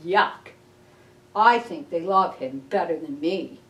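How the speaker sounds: usual speaking voice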